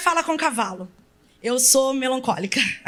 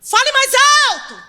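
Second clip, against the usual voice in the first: high, loud shouted command; booming pulpit command; preacher's shout; 11 semitones higher, 8 dB louder than usual. Retold or imitated. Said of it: "Certo pregador disse".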